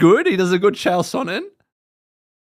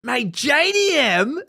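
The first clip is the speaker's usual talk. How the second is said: slow, higher Aussie "mate"; drawn-out Aussie "mate" with a grin; Aussie accent; 9 semitones higher, slower than usual. Pretend. Mock Aussie voice shouting out JDM. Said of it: "I hate doing the real Aussie accent".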